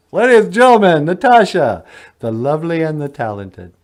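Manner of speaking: loud showman introduction; announcer. Acted. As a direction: present her like an emcee. Announcing Natasha.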